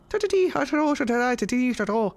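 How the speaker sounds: lightly mocked Irish leprechaun lilt; a hint of stage-Irish leprechaun babble; leprechaun accent. Mocking the game elf, a light stage-Irish leprechaun lilt. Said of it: "like, the leprechaun accent".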